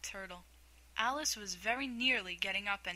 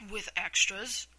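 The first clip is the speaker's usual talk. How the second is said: a little anxious; 5 dB louder, slower than usual. Acted. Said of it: "a little anxiously".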